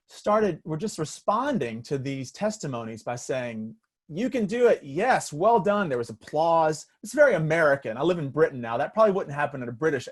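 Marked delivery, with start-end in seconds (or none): 4.1-5.9 high, loud cheer of support; mock-voiced crowd calling out praise; cheering encouragement.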